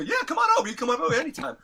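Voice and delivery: very high, loud mimicked voice; Tommy. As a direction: imitate a friend's high-voiced zinger. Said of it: "he had this high voice".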